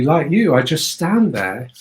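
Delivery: ordinary talk, nothing marked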